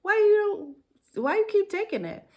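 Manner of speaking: high, loud exasperated cry; rising exasperated outcry. Retold or imitated. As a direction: scold herself in disbelief. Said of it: "where it's just like".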